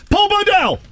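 high-pitched announcing call; big, high host introduction. Real, not acted. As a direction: hail the caller by name. Host calling in the phone guest.

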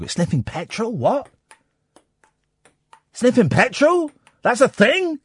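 really can't believe it's a thing; incredulous. Real, not acted. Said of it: "Flippin' heck".

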